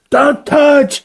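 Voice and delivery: a hammed-up protective yell; mock shout. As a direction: bark it out as a mock order. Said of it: "he goes".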